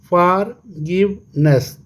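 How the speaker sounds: slow "forgiveness" in three beats, फॉर, गिव, नेस; syllable by syllable. Shown as a demonstration. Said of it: "three syllable". ("Forgiveness" तीन हिस्सों में, धीरे से)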